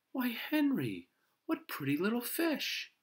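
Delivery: very high, admiring mother voice; delighted surprise. A narrator's reading. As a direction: marvel at them in a high voice.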